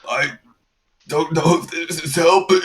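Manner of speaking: high, strained protest; struggling patient's strained, high-pitched voice; pained patient. Acted. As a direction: squeeze it out high, in pain. Patient protests during botched surgery.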